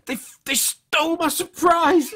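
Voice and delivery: loud, high, drawn-out indignant cry; comic outrage. Real, not acted. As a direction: wail it in outraged protest. Outraged at losing a game ship.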